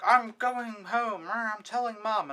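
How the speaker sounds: mock a sulky child drawing out the words; whiny kid. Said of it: "And I was like".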